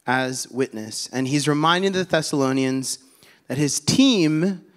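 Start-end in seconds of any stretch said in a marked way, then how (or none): 3.5-4.6 "team" drawn out slowly and punched; stress on TEAM. Real, not acted. Preacher pointing out Paul's whole team to church, heavy stress on "team". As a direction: lean hard and slow on the word "team". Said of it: "big emphasis on the word team".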